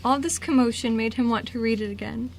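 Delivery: usual speaking voice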